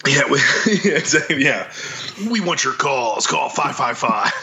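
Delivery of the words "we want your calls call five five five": mock radio host. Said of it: "radio show kinda way".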